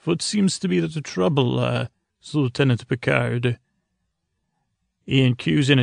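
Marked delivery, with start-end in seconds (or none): 0.0-3.6 mock German doctor's greeting; put-on German accent, pitched up at first; fake German accent. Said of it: "in, like, a, a fake German accent".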